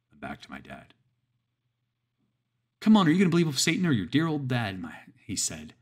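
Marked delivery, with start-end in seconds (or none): 2.8-4.8 high, loud desperate plea; pleading. A narrator's reading. Father pleads with daughter over Satan. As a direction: beg her to pick you. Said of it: "pleading to me".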